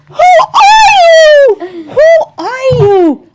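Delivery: high, slow, laughing shout of amazement; astonished disbelief. Retold or imitated. Teller reacting to her hip ninety-eight-year-old aunt, high laughing shout. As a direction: cry out in delighted disbelief. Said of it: "I'm like".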